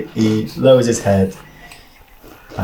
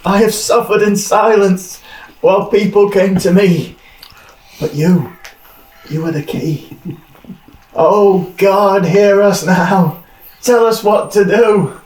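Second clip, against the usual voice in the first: fervent prayer; 8 semitones higher than usual. Acted. Priest grabs the visitor and prays, fervent ecstatic plea. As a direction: cry out to God in ecstasy.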